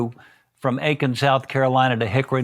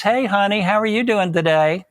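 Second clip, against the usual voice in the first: sweet mother greeting; 8 semitones higher than usual. Retold or imitated.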